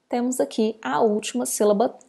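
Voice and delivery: ordinary talk, nothing marked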